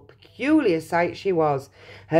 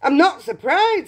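father voice; 10 semitones higher, 6 dB louder than usual. Acted.

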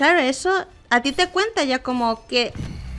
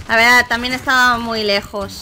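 normal talking voice

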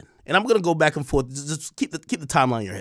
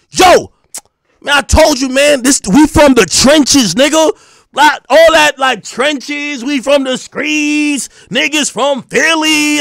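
loud, high, drawn-out hollering; mock street bragging; 9 semitones higher, 8 dB louder, slower than usual. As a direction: stretch each boast, parodying a tough crew member. Mocking caller's street bragging.

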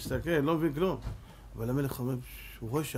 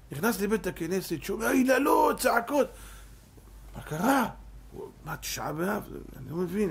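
ordinary talk, nothing marked

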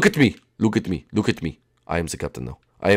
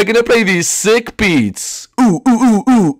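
ordinary talk

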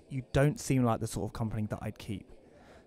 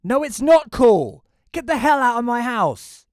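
much higher, much louder, slow shouting; furious yell; 12 semitones higher, 13 dB louder than usual. Acted. Homeowner yelling at the intruder, very loud and high. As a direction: scream at him to leave.

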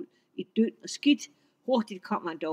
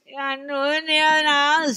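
troll yelling; 7 semitones higher, 8 dB louder than usual. Acted. Troll cheated third time.

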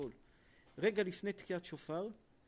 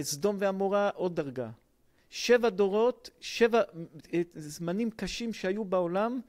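loud, rising sermon with punched phrases; fiery preaching; 9 dB louder than usual. Acted. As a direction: preach it at full force to the congregation. Preaching the seven levels. (דרשה בלהט ובקול רם)

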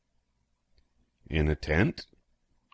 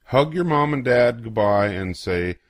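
ordinary delivery, nothing marked

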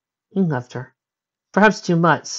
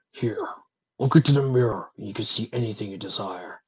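low, soft dragon offering; quietly tempting dragon; gentle dragon voice; 3 semitones lower, 4 dB quieter than usual. Acted.

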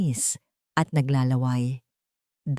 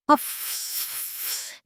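breathy puff; 4 dB quieter than usual. Acted. Wolf blows again.

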